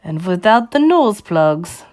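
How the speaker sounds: put-on commanding accented witch addressing her coven; imperious witch giving instructions; Grand High Witch. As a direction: dictate orders as a witch leader, stretching key words. Villain's speech in a story.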